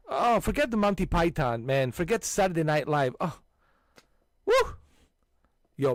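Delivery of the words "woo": woo. Real, not acted. Cheering at comedy memory.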